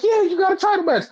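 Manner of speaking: very high, fast mock cheer; squealed, hammed-up congratulations; excited congratulating. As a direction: hype up a friend's big news. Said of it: "congratulating him".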